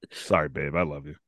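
low, dopey mocking sorry; mock apology. Mocking his cohost's apology to her husband, low and dopey. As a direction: mimic her sweet apology in a low dumb voice.

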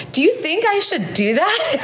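high, drawn-out question through laughter; laughing, pitch far up and slowing. Retold or imitated. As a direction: retell a hesitant question while laughing. Retells asking husband, laughing.

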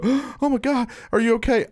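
high gasping "are you okay"; panicked parent fussing over a fall; worried parent. Retold or imitated. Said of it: "I'd never go".

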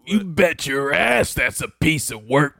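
slow, strained cowboy voice; John Marston impression. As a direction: tear at the voice box, drawing words out. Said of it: "tried to tear his fucking voice box".

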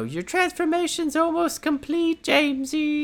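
very high, singsong put-on voice; mock taunting villainess. Villainess taunting James after throwing a knife, high mock singsong. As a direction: sing it out teasingly, far above your own pitch.

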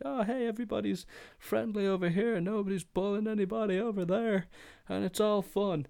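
high, sing-song innocent tone; sarcastic high dreamy voice; mocking naive voice. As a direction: parody a sheltered, cheerful kid. Mocking naive school view.

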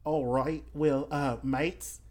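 Australian announcing a departure; rally companions as an Australian; Florals in Spring. Acted. Telling mates to get ready to go, Australian accent. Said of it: "he, um, says".

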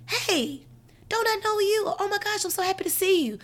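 high, fast, gushing hello; excited greeting. Acted. Someone unexpectedly recognizes you at an event, excited greeting. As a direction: greet them like a delighted old acquaintance. Acts out a surprise happy greeting.

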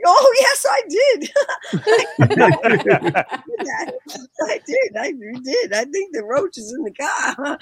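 high, laughing admission; laughing while owning up to the car; laughing confession. Retold or imitated. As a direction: giggle through the confession. Retelling her laughing confession to mother.

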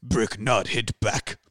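low, slow mock villain voice; deep, slow movie fighter impression; Chong Li. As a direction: drop low and space out each word. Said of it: "Chong Li in this movie".

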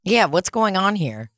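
normal talking voice

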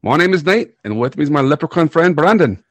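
a put-on stage Irish host greeting; poor Irish accent. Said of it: "our poor attempt at an Irish accent".

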